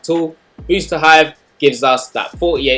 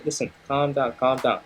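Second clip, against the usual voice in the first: calm down soothing; 7 dB quieter than usual. Pretend. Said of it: "you just say".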